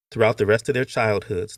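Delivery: ordinary delivery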